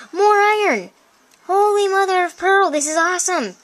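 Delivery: high-pitched amazed outburst; gasp, then exclaim it high; excited discovery. Real, not acted.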